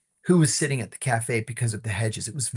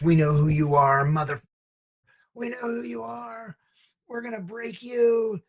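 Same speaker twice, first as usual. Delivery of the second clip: threatening; 10 semitones higher than usual. Retold or imitated. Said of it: "He threatened the guy".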